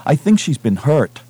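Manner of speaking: urgent but not shouted; blurted. A narrator's reading. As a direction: blurt the fear out. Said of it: "Jason blurted".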